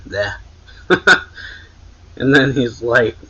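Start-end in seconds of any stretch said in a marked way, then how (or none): 0.9-1.5 short laugh. Real, not acted. Narrator laughing.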